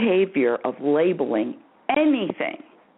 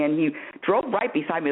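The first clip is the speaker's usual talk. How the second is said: ordinary talk, nothing marked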